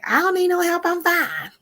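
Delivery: high-pitched mock defensive protest; angry denial. Mimicking a person in denial about anger, high mock protest. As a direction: imitate someone hotly refusing help, voice way up. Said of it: "that might be part of the anger".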